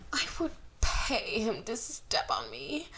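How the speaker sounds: breathy high whispered fangirl line; swooning fangirl. A narrator's reading. Narrator voicing a swooning girl in the crowd, hushed and high. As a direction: whisper it like a smitten fan.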